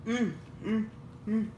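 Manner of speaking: chewing while humming; muffled closed-mouth hum; mouth full. Real, not acted. Seen 0: closed pursed lips mid-chew, fried piece held up by mouth.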